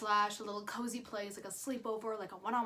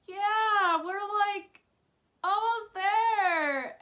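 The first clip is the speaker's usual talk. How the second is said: higher, louder forced lie, drawn out slow; nervous lie; 10 semitones higher, 8 dB louder, much slower than usual. Retold or imitated. Lying to her dad on the phone, higher, louder, slow and strained. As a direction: strain to sound fine while lying. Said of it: "I lie to him and I say".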